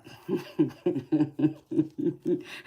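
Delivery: real high giggle in a long run; giggling laugh. Real, not acted. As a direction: giggle at your own cheeky joke. Laughing at own spicy joke.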